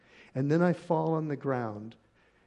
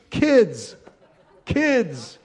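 loud, high, falling cry; mock exasperated shout; 8 semitones higher, 11 dB louder than usual. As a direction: call out sharply in pretend exasperation. Calling out at the laughing kids.

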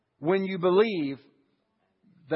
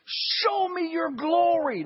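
much higher, slow pleading cry; crying out to God; 10 semitones higher, much slower than usual. Retold or imitated. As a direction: stretch it out as a longing plea. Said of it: "he cries out to God".

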